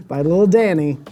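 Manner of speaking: ordinary talk, nothing marked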